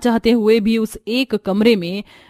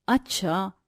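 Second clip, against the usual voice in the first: hurt resigned; 3 semitones lower, 8 dB quieter, slower than usual. Acted. Gayatri gives in, hurt.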